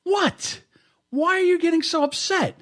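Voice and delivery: squeaky, very high-pitched protest; mock upset. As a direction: whine it in a hammed-up high voice. Mock squabble, straining in high pitch.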